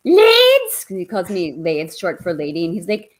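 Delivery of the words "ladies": very high, loud excited yelp of a name; Mickey Mouse on steroids calling out; Henry's dog voice. Acted. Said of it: "He sounds like".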